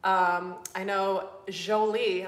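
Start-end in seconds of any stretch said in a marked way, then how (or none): 1.5-2.3 "Jolie" with a soft zh at the start; soft French J. Shown as a demonstration. Furniture painter practising a brand name, "Jolie" said zho-lee. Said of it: "I'm starting to say that right".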